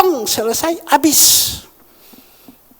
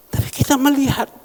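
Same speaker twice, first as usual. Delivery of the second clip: ordinary talk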